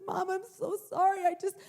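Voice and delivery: high, loud blubbering confession; hammed-up tearful child's apology; imitating weeping child. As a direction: wail it out like a sobbing kid, words tumbling. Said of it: "full on body heaving weeping".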